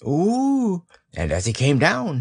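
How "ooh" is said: high, drawn-out airborne ooh; high "ooh" going up. A narrator's reading. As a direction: let out an ooh as you are bounced upward. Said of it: "he said".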